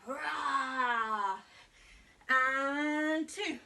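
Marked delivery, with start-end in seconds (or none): 0.1-1.4 louder playful rah; teacher roaring like a lion for kids. Pretend. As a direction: roar like a friendly lion. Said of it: "a big push-up and a roar".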